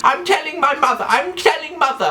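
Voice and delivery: very high, whiny tattletale squeal; piercingly high mock tantrum; Joffrey. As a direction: squeal it like a spoilt child. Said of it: "this is Joffrey, he goes".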